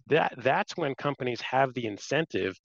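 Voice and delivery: ordinary talk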